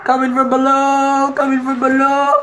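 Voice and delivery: high, loud cry of alarm; excited high shout. Real, not acted. Player yelling as the leviathan rises from below. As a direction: yell it out as it rises.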